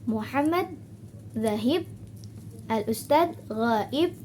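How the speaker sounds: "Muhammad zahib", "al-ustad ghaib" each ending on a rise; yes-no intonation. Shown as a demonstration. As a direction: lift the ends of "zahib" and "ghaib" as if asking. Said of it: "iya atau tidak".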